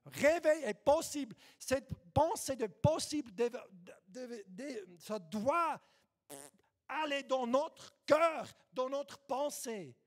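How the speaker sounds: loud fervent preaching. Real, not acted. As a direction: preach it out at full force. Preaching at full force.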